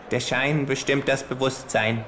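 kangaroo voice. Acted. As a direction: deliver it like a smug know-it-all.

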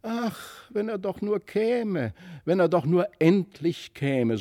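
hoch und ungeduldig, fast weinend; sehnsüchtig rufen, beinahe weinend; ungeduldig, den Tränen nah. A narrator's reading. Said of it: "so riefen beide Kinder und weinten beinahe vor Ungeduld". (die Kinder warten fast weinend auf den Onkel)

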